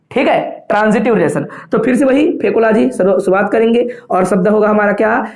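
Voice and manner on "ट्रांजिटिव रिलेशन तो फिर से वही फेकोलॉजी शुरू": ordinary talk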